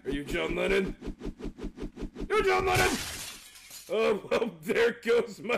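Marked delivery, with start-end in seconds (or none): 2.3-3.0 shouting. Real, not acted. Yelling at game boss.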